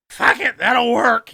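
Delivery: slow, high, loud imitation; mock drunken declaration. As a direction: toss it out as a drunk giving up. Acted quote of drunk host.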